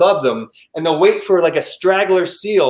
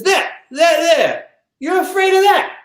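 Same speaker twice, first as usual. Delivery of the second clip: bad South African accent; 12 semitones higher than usual. Captain pointing at the sharks, incredulous mock South African. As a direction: scoff in a hammed-up South African voice. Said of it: "can't do a South African accent".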